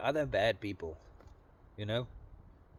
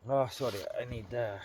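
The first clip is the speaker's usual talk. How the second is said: ordinary talk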